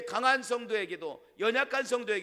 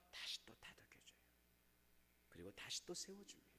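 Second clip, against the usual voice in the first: hushed narration; 9 semitones lower, 24 dB quieter than usual. Acted. Preacher hushes while describing comfort.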